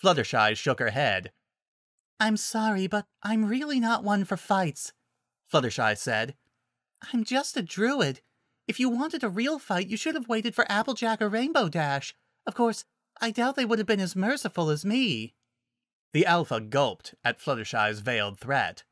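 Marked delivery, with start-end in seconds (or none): 2.2-4.9 high, gentle little voice; shy, mild and high-pitched; apologetic Fluttershy. Acted. 7.1-15.3 high, gentle little voice; shy, mild and high-pitched; apologetic Fluttershy. Acted.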